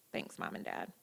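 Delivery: whispered thanks, dry; wry whispered aside. Real, not acted. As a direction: toss off a hushed dry thank-you.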